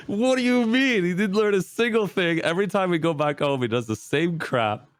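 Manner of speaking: high, loud, incredulous complaint; indignant protest. Retold or imitated. Retelling protest to therapist.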